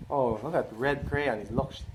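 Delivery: usual speaking voice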